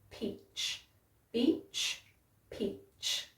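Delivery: peach, beach, peach mouthed very softly, lips pressed hard on the P; exaggerated P and B. Shown as a demonstration. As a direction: overdo the lip shape, almost voiceless. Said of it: "I'm emphasizing it a little bit".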